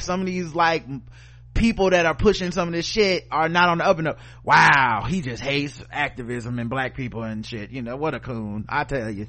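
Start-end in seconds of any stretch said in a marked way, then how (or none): 4.4-9.3 mock critic voice, much lower and softer; sarcastic mockery. Host mimics his critics with low sarcastic mockery. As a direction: sneer it out as the people who called him names.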